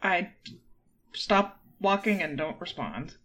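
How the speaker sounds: normal talking voice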